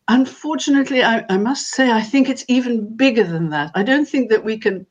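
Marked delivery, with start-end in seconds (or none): none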